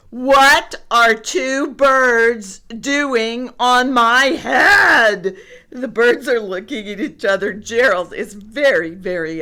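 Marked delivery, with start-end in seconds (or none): 0.1-5.4 very loud, slow, high demand; exasperated elephant at full volume; outraged. A narrator's reading. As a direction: bellow each word slowly in outrage. Upset elephant, acted in story.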